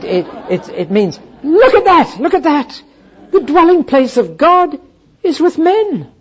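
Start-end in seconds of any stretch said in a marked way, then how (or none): 1.4-6.1 high, loud, laughing cry of wonder; the angel marvels aloud, overjoyed; astonished angel cry. Retold or imitated. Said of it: "the astonishment as the angel cries out".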